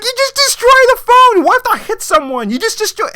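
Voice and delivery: high, loud disbelieving outburst; exasperated yell. Retold or imitated. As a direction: shriek at the screen in disbelief. Mocking the daughter dropping phone.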